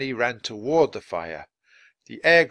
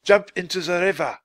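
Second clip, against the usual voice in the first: raised, urgent command; higher, louder warning call; 6 semitones higher, 5 dB louder than usual. A narrator's reading. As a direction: call out the escape order. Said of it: "called Basho".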